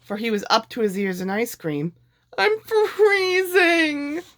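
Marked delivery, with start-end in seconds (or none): 2.4-4.2 very high, very slow wail; freezing panic. A narrator's reading. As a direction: wail as the cold engulfs you. Lord buried in ice cream.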